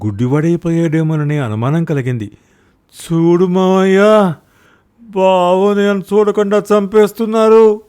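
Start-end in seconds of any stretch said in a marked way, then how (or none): 2.9-7.8 much higher, louder, slow wail; wailing lament. Acted. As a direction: cry out like a beaten man. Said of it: "లబోదిబోమన్నాడు Brahmanandam".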